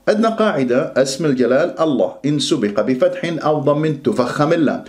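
ordinary talk

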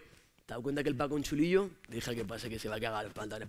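hushed, low conspiratorial lad; scheming whisper. Acted. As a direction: plot the prank under your breath. Lads plotting a scare. (chico susurrando a su colega antes de asustar a Paco)

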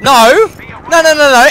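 high, loud desperate cries; yelling no. Real, not acted. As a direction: shout it in panic. Player dies in game.

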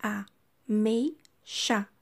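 "a, mei, xa" said as three quick separate syllables; teacher splitting "ameixa". Shown as a demonstration. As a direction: open the mouth once per syllable. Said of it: "Eu abro a boca três vezes pra falar".

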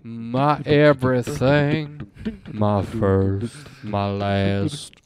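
slow, deep soul crooner. Retold or imitated. Mimicking the seduction soundtrack, slow deep croon.

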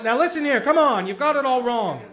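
ordinary talk, nothing marked

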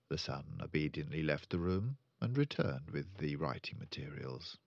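plain reading voice

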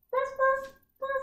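quick high woofs; high-pitched little barks; dog bark. Shown as a demonstration. Teacher leading children as wiggly dogs, high barking. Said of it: "and you can bark".

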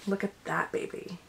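ordinary delivery

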